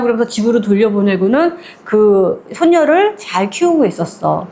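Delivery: usual speaking voice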